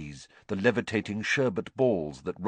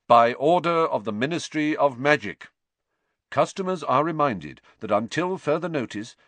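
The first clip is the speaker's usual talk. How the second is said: official notice; 4 semitones higher than usual. A narrator's reading. Ron reading the Ministry notice aloud, formal. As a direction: announce it like a town crier.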